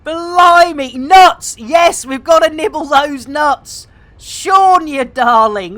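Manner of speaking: high, loud excited yelling; excited shout. Real, not acted. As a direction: whoop the name out with joy.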